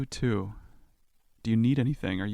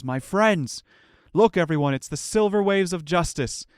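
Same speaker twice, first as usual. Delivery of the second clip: calling out; 6 semitones higher, 6 dB louder than usual. Acted. NPC Zardoz greeting party loudly.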